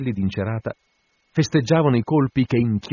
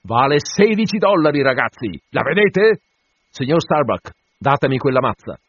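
loud, high call to the crew; captain rallying his men loudly; 5 semitones higher, 6 dB louder than usual. Acted. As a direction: show off the prize in a raised, ringing voice. Captain addresses the assembled crew.

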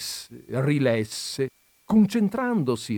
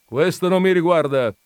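higher, loud cut-in; brusque interruption; 8 semitones higher, 9 dB louder than usual. A narrator's reading. Visitor interrupting the countess's boast, brusque. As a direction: cut across with a firm dismissal. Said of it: "interruppe l'altro".

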